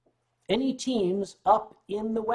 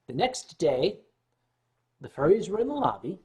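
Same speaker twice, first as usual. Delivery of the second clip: normal talking voice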